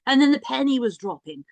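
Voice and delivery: ordinary talk, nothing marked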